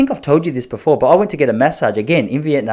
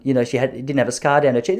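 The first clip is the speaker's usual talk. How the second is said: ordinary talk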